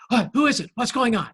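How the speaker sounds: jolted awake, calling out in alarm; startled wake-up. Retold or imitated. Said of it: "he startled and woke right up and said".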